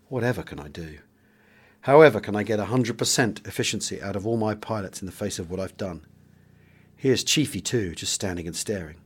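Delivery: measured first-person memoir reading; audiobook narration. A narrator's reading. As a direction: read it like a wartime diary, steady and grave. Reads war memoir aloud.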